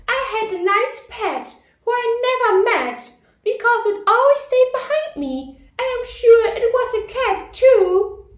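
put-on high, loud little girl reciting; recited slowly. A narrator's reading. Ruby recites her poem to the class, high child voice. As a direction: say it like a small girl reciting carefully. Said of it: "recited slowly".

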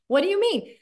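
high, affronted question; mock bafflement. People reacting to the takeaway, high mock disbelief. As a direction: protest as if unfairly left out.

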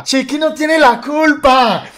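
high, loud, drawn-out mock shout; imitated desperate yell. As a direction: yell it as if from a car window. (grito desesperado y alargado, imitado)

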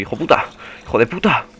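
loud, worked-up insults; angry cursing. Real, not acted. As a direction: curse at the enemy mid-fight. Curses the zombie while fighting.

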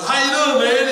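high, loud greeting from afar; calling out. Retold or imitated. Greeting a childhood friend across Burger King, loud call.

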